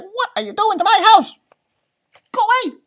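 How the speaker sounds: high, loud squeaky outrage; angry leprechaun. Acted. Leprechaun shouting at the dog to go away. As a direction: shoo the giant dog away in a fury. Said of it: "he's like".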